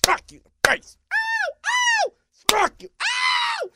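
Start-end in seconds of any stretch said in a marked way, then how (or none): 1.1-3.7 high-pitched hammed-up yelps; mock screams of pain. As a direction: squeal like it hurts, playfully. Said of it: "Don't scream".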